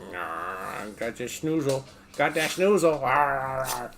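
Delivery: slow coo. Real, not acted. Cooing at the dog.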